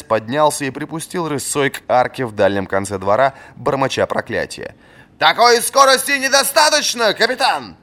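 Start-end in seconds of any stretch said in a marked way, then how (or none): 5.2-7.8 very high, loud call after the runner; shouted after him. A narrator's reading. Old master shouting after the retreating captain. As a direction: yell after him as he jogs away. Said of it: "крикнул вслед ему Варус".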